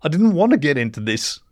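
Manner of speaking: understated weepy regret; tearful regret. A narrator's reading. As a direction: say it with a touch of a sob. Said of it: "He kept sobbing, but nodded".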